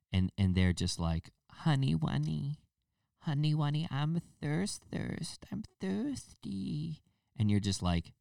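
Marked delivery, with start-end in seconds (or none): 1.5-7.0 put-on high, slow, cooing baby talk; mock baby voice. Couple on a date cooing in baby talk to each other. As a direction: coo it like a grown adult playing baby. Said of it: "people who do baby voices".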